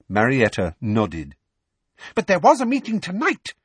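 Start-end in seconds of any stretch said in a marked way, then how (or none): 2.1-3.5 furious. A narrator's reading. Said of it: "said Umbridge furiously".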